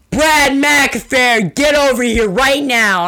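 high, loud, slow angry teacher yell; teacher shouting across the field for Brad. Retold or imitated. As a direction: call the kid over like a furious teacher. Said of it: "all I hear is".

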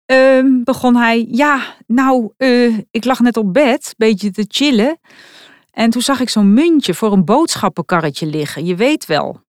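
a lightly voiced teenager stalling before a confession; awkward son, reluctant to admit it; sheepish teen son. A narrator's reading. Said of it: "Schoorvoetend kwam zoon Stag".

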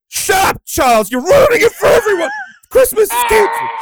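shrieked way up high and loud, mock outraged; high shrieking yell. Shown as a demonstration. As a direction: shriek it like a panicked spaceman. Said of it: "You ever do any dying voices?".

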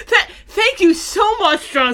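high, slow words through laughter; thanking while cracking up; real laughter. Real, not acted. Co-host thanking the pirate for explaining, laughing.

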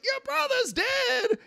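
high, slow drawn-out shout; mock yell. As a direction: stretch it out like a desperate mother. Ma yelling again.

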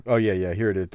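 ordinary talk, nothing marked

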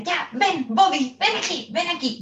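high-pitched, insistent yelling for the dog; acted scolding shout; mock angry calling. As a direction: call the dog over sharply, voice pushed up high. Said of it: "empieza a gritar".

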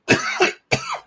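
high, strained coughing fit; hacking cough. Real, not acted. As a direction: cough hard. After smoking, coughing hard.

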